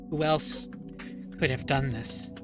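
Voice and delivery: plain reading voice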